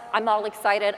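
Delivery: ordinary delivery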